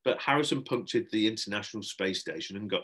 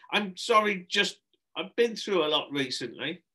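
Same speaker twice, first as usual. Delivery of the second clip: higher, pleading apology; flustered apology; 8 semitones higher, 4 dB louder than usual. Acted. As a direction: excuse an outburst to upset children. Flustered adult apologising after outburst.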